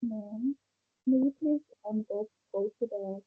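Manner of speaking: faint, far-off voice; words almost lost, very soft; barely audible. Real, not acted. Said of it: "You sound so faint".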